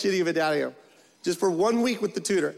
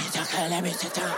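soft mock plea with a laugh; a hint of a pleading boy; pleading kid; 3 semitones lower, 3 dB quieter than usual. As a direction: beg for the seat softly. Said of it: "She's like".

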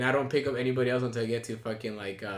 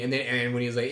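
usual speaking voice

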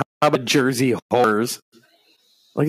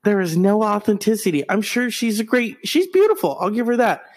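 ordinary delivery

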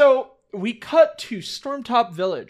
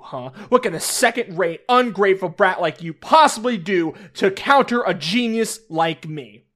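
ordinary delivery

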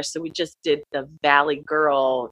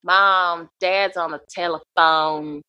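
put-on Southern accent. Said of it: "we were doing Southern accents".

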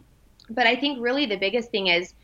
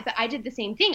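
normal talking voice

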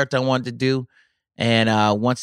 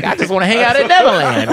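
laughing; 8 semitones higher, 6 dB louder than usual. Retold or imitated. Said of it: "I'm like".

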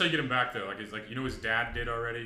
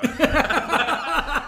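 loud laugh; 11 dB louder than usual. Real, not acted. Big laugh at end.

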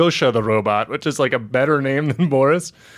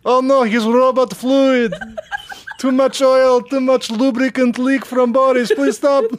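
high whiny robot begging for mercy; pleading robot voice; 11 semitones higher, 3 dB louder than usual. Pretend. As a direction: beg like a kicked robot, pitched up.

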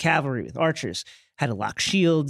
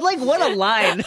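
higher, slow words breaking into laughter; laughing; 3 dB louder than usual. Real, not acted. Host mocking Jon's cheesy line while laughing hard. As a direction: laugh through the mockery.

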